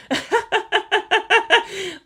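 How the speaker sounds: high-pitched falling gloating laugh; high villain laugh. Acted. Caro laughs at the new order, gloating. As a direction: let a giddy evil laugh fall away.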